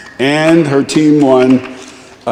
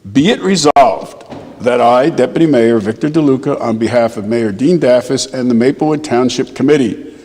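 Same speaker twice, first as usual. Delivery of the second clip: formal proclamation; 2 semitones higher than usual. Acted. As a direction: proclaim it like an official decree. Reads official proclamation.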